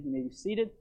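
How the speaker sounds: usual speaking voice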